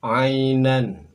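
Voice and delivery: "Ainan" loud, throaty ain at the start; model Arabic reading. Shown as a demonstration. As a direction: sound out "Ainan". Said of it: "Berikutnya silakan". ("Ainan" dengan ain dari tenggorokan)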